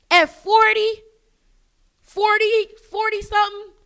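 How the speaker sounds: loud, high-pitched disbelief; incredulous outrage. Retold or imitated. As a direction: throw the age back in disbelief. Retold disbelief at the woman's age.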